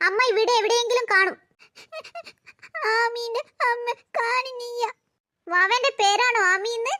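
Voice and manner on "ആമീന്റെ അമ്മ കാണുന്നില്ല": put-on slow, soft babyish lisp; toddler voice. Little Aami tells Sneha her mother is gone, babyish. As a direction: talk like a tiny lost girl. Baby says mother is missing.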